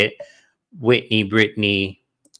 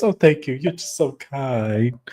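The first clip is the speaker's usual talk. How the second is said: mock-pitiful gratitude, drawn out; putting on; 5 dB quieter than usual. Host mimics castmate thanking her comforter, hammed-up pitiful thanks. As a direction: play the grateful martyr, trailing away slowly. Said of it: "putting on".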